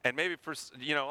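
ordinary delivery

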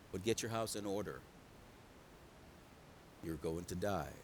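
very low, hushed delivery of bad news; quiet, regretful-sounding pronouncement; gentle, grave verdict. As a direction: break it softly and solemnly. God gently gives the hard news.

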